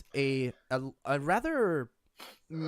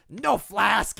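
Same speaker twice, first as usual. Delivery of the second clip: goblin voice; 6 semitones higher, 7 dB louder than usual. Acted.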